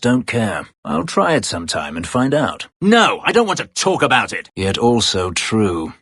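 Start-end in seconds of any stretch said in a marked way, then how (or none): 2.8-4.5 loud, high snapping refusal; angry refusal. Acted. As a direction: cut the topic off in anger. Refusing, voice raised.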